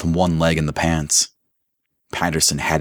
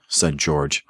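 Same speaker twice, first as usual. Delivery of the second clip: plain reading voice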